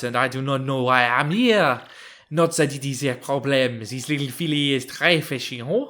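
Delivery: hammed-up, slowish French accent; bad French accent. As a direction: play a puzzled Frenchman with a terrible French accent. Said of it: "my worst French accent aside".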